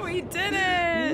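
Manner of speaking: high, laughing cry of triumph; excited cheer. Real, not acted. Puzzle solved, celebrating.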